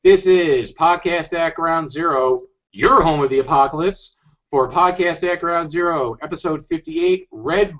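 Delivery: upbeat announcer opening; podcast intro. Acted. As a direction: welcome listeners like a radio host.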